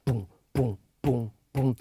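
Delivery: low, soft, evenly spaced "boom"; kick drum imitation. Shown as a demonstration. Producer lays out the kick pattern, soft kick drum imitation. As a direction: mouth a soft deep kick drum pulse. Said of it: "kick drum".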